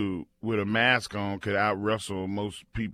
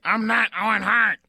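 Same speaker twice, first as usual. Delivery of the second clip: put-on higher voice denying it; mock innocent disguised wrestler; hammed-up fake denial; 8 semitones higher, 5 dB louder than usual. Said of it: "Making these fake voices and stuff".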